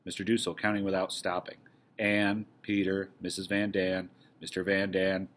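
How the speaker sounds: ordinary talk, nothing marked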